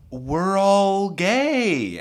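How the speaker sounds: high, very slow, loud reveal; drawn-out, emphatic confession; deliberate announcement. Retold or imitated. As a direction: stretch each word out for the big reveal. Dramatic reveal to end her pursuit.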